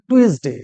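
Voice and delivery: টুয়েসডে with a hard "t", "tu-es-de"; mispronounced Tuesday. Shown as a demonstration. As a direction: say টুয়েসডে as spelled, "too-es-day". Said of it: "ভুল বলি". (টুয়েসডে-র ভুল উচ্চারণ, "টু-এস-ডে")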